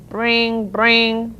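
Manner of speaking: ringing, drawn-out bell trills; imitated bicycle bells. A narrator's reading. Boys on bicycles ring their bells, ringing imitation. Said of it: "the boys rang their bells".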